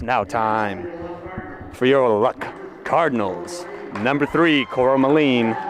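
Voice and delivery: drawn-out arena lineup call, names stretched slowly; PA announcer. Acted. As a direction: announce each starter to the gym crowd. PA announcer reads starting lineups.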